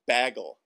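"bagel" said as "BAG-el"; Midwestern pronunciation. Shown as a demonstration. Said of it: "make fun of the way I said".

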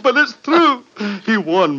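high, loud nervous laugh into protest. Acted. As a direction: laugh wildly then insist. Frantic insistence after laughing.

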